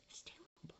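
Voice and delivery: whispered aside, almost no voice. Real, not acted. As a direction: whisper it to yourself.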